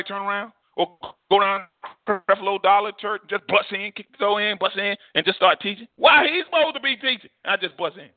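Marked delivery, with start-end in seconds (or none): 5.9-7.3 high-pitched protest; mock indignant objection. Preacher voicing startled church at his barging in, indignant. Imagined congregation objects to intruder.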